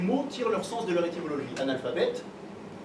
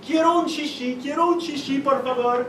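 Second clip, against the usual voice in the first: higher, broken Spanish call; 5 semitones higher, 3 dB louder than usual. Retold or imitated. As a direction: call out asking for churros. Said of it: "j'ai commencé à dire un petit peu partout".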